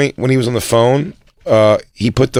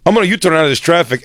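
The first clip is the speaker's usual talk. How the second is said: fast, higher, brash announcement; imitating the driver; 6 semitones higher, faster than usual. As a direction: blurt it out impatiently. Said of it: "he just goes".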